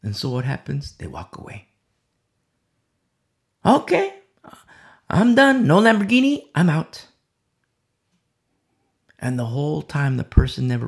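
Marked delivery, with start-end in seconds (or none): 3.6-7.1 high-pitched mock giving up; fed-up quitting. Disappointed believer walking away from faith, mock fed-up. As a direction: act out throwing in the towel.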